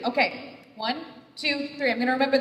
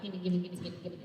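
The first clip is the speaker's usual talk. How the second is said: quicker, softer greedy gimme; greedy mock gimme; 5 semitones lower, 11 dB quieter than usual. Book lover mock-grabbing audiobooks, softly greedy. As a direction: grab for more books, softly. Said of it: "I'm like".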